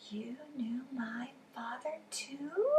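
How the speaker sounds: slow amazed whisper; awed whisper. Acted. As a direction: breathe it out in wonder. Child amazed, hushed.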